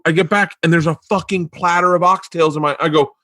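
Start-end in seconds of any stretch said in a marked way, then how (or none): none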